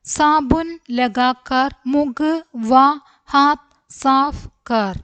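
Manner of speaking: slow, measured, each word set apart; word-by-word reading. Shown as a demonstration. Teacher reading a Hindi sentence for learners, word by word. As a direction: read out slowly with even beats.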